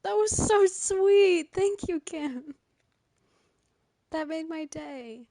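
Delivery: high, slow, sing-song coo; gushing coo. Real, not acted. Streamer thanking Kim for kind words, high slow coo. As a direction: melt over the compliment. Streamer moved by chat's praise.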